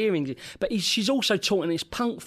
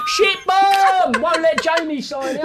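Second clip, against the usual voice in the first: mock shout; 12 semitones higher, 8 dB louder, slower than usual. Retelling mate's antics.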